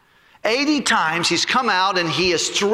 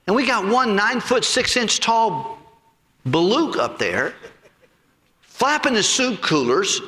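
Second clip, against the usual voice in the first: ordinary delivery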